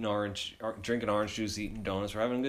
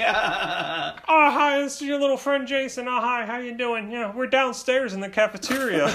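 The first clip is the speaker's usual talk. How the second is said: high-pitched dad small talk, imitated; chatty dad; 10 semitones higher than usual. Dad greeting his son's friend, high hammed-up small talk.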